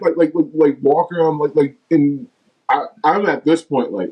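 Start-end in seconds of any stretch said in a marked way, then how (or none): none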